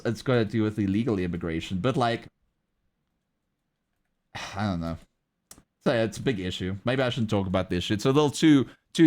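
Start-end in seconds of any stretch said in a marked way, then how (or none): 4.4-5.0 sigh, then a low, soft "I don't know"; giving up, lower and softer; sighing resignation. Real, not acted. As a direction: let the words drop with a tired sigh. Gives up on the topic.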